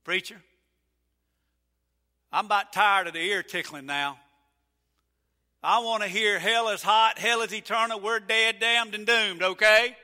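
loud, demanding preacher-fan yell; demanding shout. Retold or imitated. Churchman calling to the preacher, loud and demanding. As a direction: bark it like a hellfire fan. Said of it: "This side of the room".

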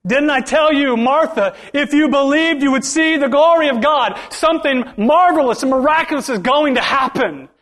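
high, loud, pleading Jesus; preacher booming as Jesus; forceful Jesus voice. Acted. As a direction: belt it out as Jesus to Martha. Preacher voices Jesus rebuking Martha.

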